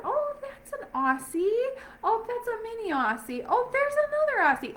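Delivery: giddy high pitched noticing every Aussie; excited spotting. Retold or imitated. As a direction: squeal out each sighting like a thrilled dog lover. Imitating herself spotting Aussie dogs.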